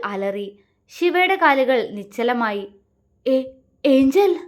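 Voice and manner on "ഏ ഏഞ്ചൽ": slow, stammering call in disbelief; stunned call. A narrator's reading. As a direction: stammer her name, frozen in shock. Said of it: "അവൻ പകപ്പോടെ വിളിച്ചു". (പകപ്പോടെയുള്ള വിളി)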